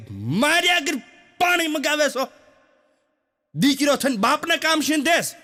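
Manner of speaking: high, indignant scolding father; angry father. Retold or imitated. Father scolds son for asking for water. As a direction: bark at the son for giving orders.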